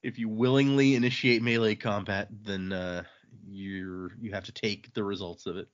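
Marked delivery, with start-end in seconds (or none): none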